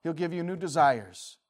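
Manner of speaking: ordinary talk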